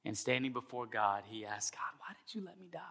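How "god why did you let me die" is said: near-whispered plea to God; acted whisper, barely voiced; hushed question. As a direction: ask it very softly, bewildered. Dead man asks God timidly.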